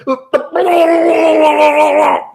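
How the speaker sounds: long, loud, high imitated vomit noise; exaggerated gurgling puke sound, drawn out; mock retching. Imitating his date throwing up, mock retching. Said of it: "she started, like, throwing up".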